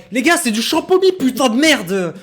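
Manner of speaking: loud, high, outraged protest; exasperated outburst. Real, not acted. Streamer to chat about the alcohol flag, loud outraged protest. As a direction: vent at the absurd ban.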